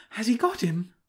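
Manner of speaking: high-pitched eager question; anxious onlooker. A narrator's reading. Onlooker asking about the chase, high anxious voice. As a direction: ask it high, in suspense.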